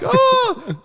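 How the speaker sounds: very high, loud "oh"; squeaky stunned exclamation; overwhelmed gasp. Retold or imitated. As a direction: let it burst out in shock. Overwhelmed after hanging up.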